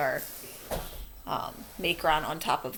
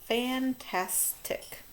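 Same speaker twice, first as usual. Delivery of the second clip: Fantastic said whole, slow and drawn out; slow dictation of Fantastic; slow whole word; 5 semitones higher, much slower than usual. Shown as a demonstration. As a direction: blend the syllables back, slowly. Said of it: "we're going to spell fantastic".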